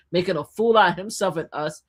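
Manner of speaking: ordinary delivery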